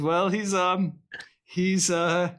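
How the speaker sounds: stall, then own up awkwardly; imitating a hesitant, sheepish explanation; sheepish admission. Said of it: "The delivery of this".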